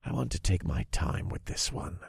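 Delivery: soft, self-assured menace; sure grin. A narrator's reading. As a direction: say it quietly, savouring it with a grin. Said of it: "I said with a sure grin".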